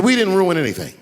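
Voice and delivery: indignant producer. Retold or imitated. Producer denying blame to angry young comic, indignant. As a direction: push back as the accused producer. Producer argues back in retold quarrel.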